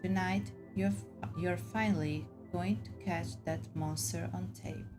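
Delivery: ordinary delivery, nothing marked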